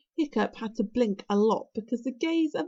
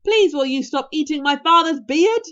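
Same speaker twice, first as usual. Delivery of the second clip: loud, higher-pitched polite plea; yelling; 5 semitones higher, 11 dB louder than usual. A narrator's reading. Hiccup begging Toothless off his father's beard, yelling. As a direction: yell the request as loud as possible. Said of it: "yelled as loudly as he could".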